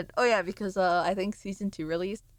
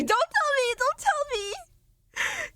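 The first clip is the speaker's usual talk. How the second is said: high, stretched cry of alarm; mock spoiler panic; much slower than usual. Streamer begs chat not to spoil the season. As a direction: wail to block the spoiler.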